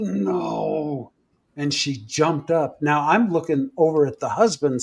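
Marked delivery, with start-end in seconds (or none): 0.0-1.1 restrained, drawn-out moaning "no"; unwomanly possessed voice. Retold or imitated. Retelling a possessed woman's refusal, eerie unwomanly moan. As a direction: imitate a possessed moan without much volume. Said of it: "in this voice that did not belong to a woman".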